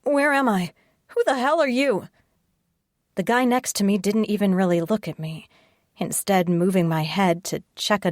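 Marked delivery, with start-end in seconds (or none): 0.0-2.1 panicked questions. Acted. Panic starting to squeeze my chest.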